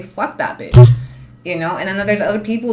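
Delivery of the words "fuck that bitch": low, loud, blunt swear. Retold or imitated. Showing viewers her blunt expressions, low and loud. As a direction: drop it low and hard. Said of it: "my expressions when I say".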